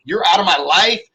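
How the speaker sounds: a hammed-up furious yell; imitated rage. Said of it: "and you're like".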